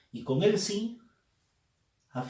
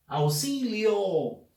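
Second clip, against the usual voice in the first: súplica de auxilio; 5 semitones higher, much slower than usual. Shown as a demonstration. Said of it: "el pedido de auxilio".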